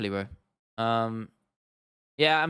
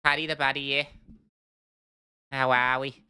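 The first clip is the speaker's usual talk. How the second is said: mock Scouse accent; jokey Scouse impression; Paddy the Baddy. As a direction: try out a Liverpool catchphrase voice. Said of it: "Paddy Axon, what a scouse".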